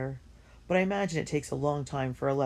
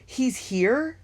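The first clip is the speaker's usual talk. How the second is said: high, loud thrilled question; can it really be true?; excited disbelief; 10 semitones higher, 7 dB louder than usual. Acted. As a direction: musicians stunned at the news, breathless and high. Men thrilled the violinist came.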